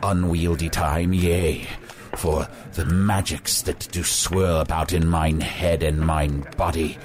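low, slow archaic wizard oratory; pompous wizard. Acted. Wizard boasting of his perilous dreams, grandly portentous.

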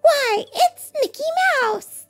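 very high, excited elf squeal; little elves' delighted cry; elves crying out. Acted. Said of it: "they all cried together".

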